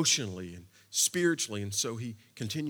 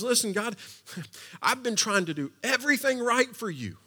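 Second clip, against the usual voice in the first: higher, louder exasperated plea; complaining plea; 8 semitones higher, 8 dB louder than usual. Acted. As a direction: pour out a frustrated complaint to God. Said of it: "Elijah says".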